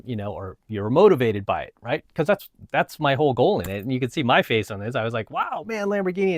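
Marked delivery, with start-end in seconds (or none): 5.3-6.4 high-pitched retold wow; mock awe. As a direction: act out being amazed, voice lifted high. Acts out own awe at car.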